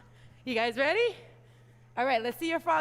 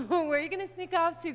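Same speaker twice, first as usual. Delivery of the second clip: normal talking voice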